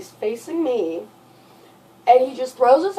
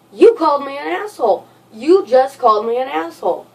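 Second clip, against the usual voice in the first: mild mock yell, higher; retold screaming; 6 semitones higher, 5 dB louder than usual. Boyfriend accusing girlfriend, mild mock yell. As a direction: imitate him accusing her, a touch louder. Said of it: "starts screaming at me".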